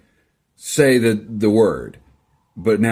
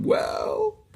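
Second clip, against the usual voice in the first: mock shout; 6 dB quieter than usual. Real, not acted. Mock retort to commenter.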